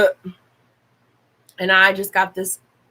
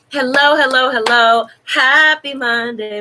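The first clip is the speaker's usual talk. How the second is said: bright loud hello to chat, higher; excited greeting; 6 semitones higher, 4 dB louder than usual. Real, not acted. Streamer greeting her viewers with a loud excited hello. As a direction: call out a big cheerful welcome.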